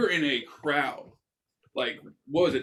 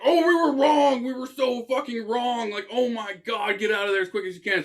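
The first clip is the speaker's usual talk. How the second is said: higher, louder frantic officials; mock panic; 7 semitones higher, 3 dB louder than usual. Weather officials telling crowds to flee, hammed-up frantic panic. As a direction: blurt the warning in hammed-up panic.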